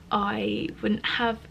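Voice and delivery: normal talking voice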